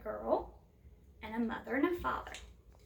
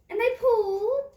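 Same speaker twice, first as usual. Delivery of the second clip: much higher and louder, heaving with effort; straining effort; 9 semitones higher, 9 dB louder than usual. A narrator's reading. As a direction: push it up like everyone is straining. Building effort of the pull.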